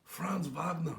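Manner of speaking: hushed whisper of the name; whispered reverently. Pretend. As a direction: breathe the name in awe. Name repeated hushed after loud.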